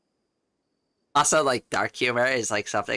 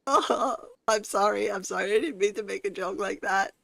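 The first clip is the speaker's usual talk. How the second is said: laughing apology; 8 semitones higher than usual. Retold or imitated. Retelling apology to a serious coworker, giggling and high. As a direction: giggle out a flustered sorry.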